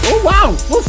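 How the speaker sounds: sudden high yelp of surprise; startled by confetti. Real, not acted. Streamer startled when the confetti cannon fires, yelping high. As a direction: jump at the bang and yelp.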